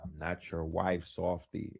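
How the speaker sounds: hushed, low mocking echo; mocking quote. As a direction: quote her under your breath, mocking. Mocking the therapist's line.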